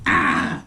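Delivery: deep, growled ah noise; low crazy groan. Retold or imitated. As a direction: drop low into a strange groan. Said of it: "It be, like, crazy sounding".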